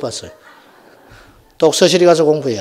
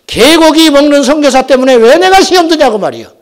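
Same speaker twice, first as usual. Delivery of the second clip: loud, high outburst; exasperated protest; 11 semitones higher, 9 dB louder than usual. Acted. Pastor protesting a church split over dog meat, exasperated shout. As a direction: cry out in indignant disbelief.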